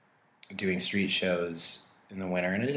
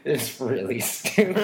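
laughing; 5 semitones higher, 4 dB louder, slower than usual. Real, not acted. Laughing at own story.

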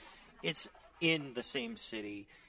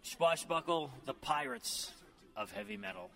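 hyped band intro; 6 semitones higher than usual. Acted. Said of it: "announcing the band".

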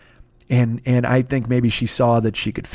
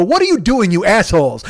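mock indignation; 8 semitones higher, 6 dB louder than usual. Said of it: "And she would go".

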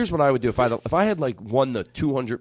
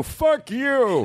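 put-on old drummer yelling back; 11 semitones higher than usual. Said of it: "And he'd go".

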